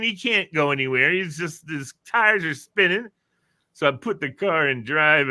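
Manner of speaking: normal talking voice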